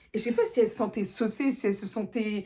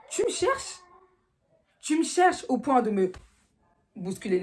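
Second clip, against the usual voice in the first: much higher, accusing cry; provoked anger; 4 semitones higher than usual. Retold or imitated. Girl accuses her rival of provoking her, high and sharp.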